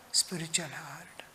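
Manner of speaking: hushed whispered answer. Real, not acted. Preacher answering his own question in a whisper. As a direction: breathe the answer softly.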